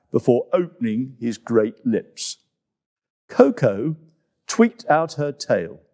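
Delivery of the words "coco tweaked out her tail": ordinary delivery, nothing marked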